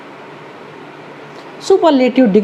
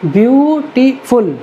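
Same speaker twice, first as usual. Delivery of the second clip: Beautiful said slowly and clearly as a sample word; slow example word; 3 semitones higher, much slower than usual. Shown as a demonstration. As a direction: present beautiful slowly to the class. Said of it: "तीन syllable हैं".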